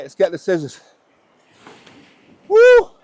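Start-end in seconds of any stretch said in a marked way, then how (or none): 2.5-2.9 loud high whoop; excited whoop. Real, not acted. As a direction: yell it out in triumph. Celebrating the catch.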